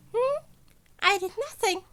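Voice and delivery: put-on sly, amused old mentor toying with his student; Nezu voice.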